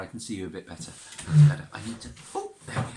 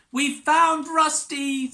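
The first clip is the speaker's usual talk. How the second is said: higher, louder excited cry; excited children; 6 dB louder than usual. Acted. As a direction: proudly announce the find. Children announce finding dog.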